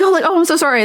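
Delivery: high, put-on apologetic voice; mock apology. Worker excusing herself for yawning, mock-apologetic. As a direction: gush a fake sorry. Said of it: "you must be like".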